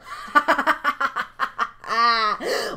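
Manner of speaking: long, staccato villain cackle; drawn-out evil laugh. Acted. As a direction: laugh at length in cruel delight. Villain laughs as blade falls.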